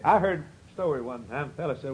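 ordinary talk

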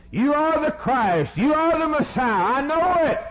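high, insistent declaration; crying out. Acted. Beggar crying out his faith in Jesus, high and insistent. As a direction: proclaim it high and certain. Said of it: "you look at his cry".

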